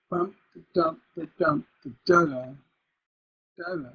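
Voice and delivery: chanted beat, final "da, da" strong then weak; rhythmic syllables ending unstressed on "da"; feminine ending. Shown as a demonstration. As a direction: punch "dump", leave the last "da" hanging. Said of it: "If I create feminine ending".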